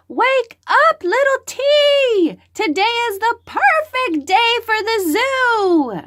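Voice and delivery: high, loud cheerful wake-up call; excited mother voice. Acted. Mother waking her child for zoo day, high and excited. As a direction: rouse the little one with a bright singsong.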